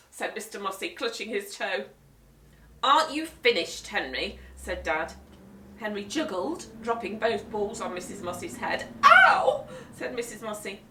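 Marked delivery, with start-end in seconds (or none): 2.8-4.4 louder exasperated question; hint he should stop; impatient dad. Acted. Dad asking Henry to finish, impatient. 9.0-9.7 much higher, louder yelp; sharp ow. Acted. Guest hit by juggling balls, loud yelp.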